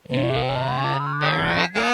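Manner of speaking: slow pitch-sweeping effect; rising modulated voice; warbling effect voice. Shown as a demonstration. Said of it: "playing with the depth".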